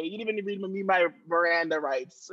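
normal talking voice

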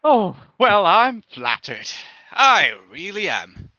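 slow, savoring the compliment; flattered lunch lady. Acted. Lunch lady praised for her cooking, slowly flattered. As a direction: open high, then settle into pleased, drawn-out words.

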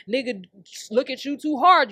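ordinary talk, nothing marked

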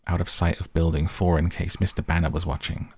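plain reading voice

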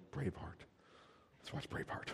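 breathless, hushed gushing over a gory film; wife's mock whisper. Preacher mimics wife hushed, craving a bloody movie. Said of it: "Your wife will go".

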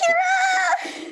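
high, drawn-out shout; calling out. Acted. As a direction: call across the sky, high and long. Calling out across sky.

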